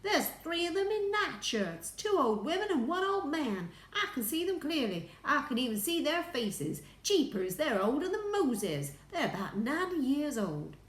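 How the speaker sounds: high, loud astronaut protest; insistent, excited. A narrator's reading. Astronaut insisting on what he sees, excited.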